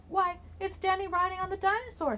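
put-on high, amazed little kid; child voice. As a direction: call out like a wide-eyed child. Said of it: "said a child".

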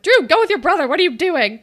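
mock-yelling at a character; exasperated yell. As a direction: shout at the screen to go with his brother. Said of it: "And I'm like".

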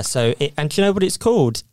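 ordinary talk

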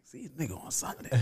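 whispered disbelieving question. Retold or imitated. Boyfriend reading the text, whispered disbelief. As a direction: hiss it to himself. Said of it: "I'm like".

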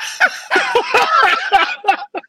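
loud high laugh; high cackling laughter. Real, not acted. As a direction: laugh hard at your own joke. Laughing at own joke.

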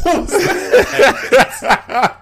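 high, very slow, laughing through the words; laughing disbelief. Retold or imitated. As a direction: say it while cracking up at the blatant count. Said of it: "I'm like, I'm like".

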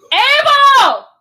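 high, loud, drawn-out call; real shout. Real, not acted. Parent calling out to a loud child, sharp real shout. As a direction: holler the name across the house.

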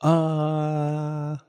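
Geo impression. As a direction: stall like Geo pondering. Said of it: "Are you doing a Geo impression?".

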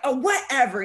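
loud, brushing-off whatever; dismissive. Retold or imitated. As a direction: wave it away loudly. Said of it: "And I was like".

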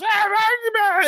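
high screeching goblin babble; goblin yelping. Acted. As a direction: squeal wordlessly like a little goblin. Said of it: "then Chunks goes".

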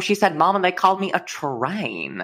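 hammed-up Southern mother; Southern accent. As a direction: tell it as a put-on Southern mama. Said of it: "Southern accents".

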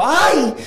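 high, loud mock yelp of pain; imitated yelp. Retelling how the woman yelped in bed, a high mock yelp. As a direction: squeal the Ay like she got hurt. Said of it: "esa cabrona pegó un grito".

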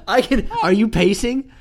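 laughing through the question; giggling while asking. Real, not acted. Laughing at pacing friend.

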